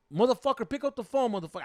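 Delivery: high, forceful cursing down the phone; retold yelling. Boyfriend to the silent rival on the phone, yelling. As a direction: act out an angry demand, loud at the start. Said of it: "like, yelling at him".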